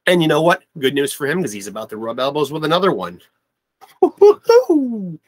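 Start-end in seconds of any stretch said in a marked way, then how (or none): 4.0-5.2 loud, very high falsetto "whoo"; high whoop. Real, not acted. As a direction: let out a long high rock howl. Excited frontman whoop.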